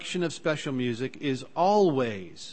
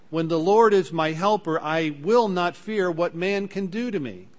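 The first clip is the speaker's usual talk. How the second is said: louder, ringing declaration of faith; fervent quotation; 5 semitones higher, 6 dB louder than usual. Acted. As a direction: proclaim it boldly to the congregation. Preacher quoting scripture.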